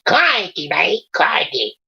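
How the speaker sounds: high-pitched, hammed-up Australian accent. Reader warming up as Brownie, high Australian accent. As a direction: put on a high Aussie character voice. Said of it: "Brownie with an Australian accent".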